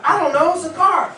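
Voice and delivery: high, loud, blank "I don't know"; high, loud, clueless answer to a best friend; clueless buyer. Acted. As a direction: play the buyer who has no idea.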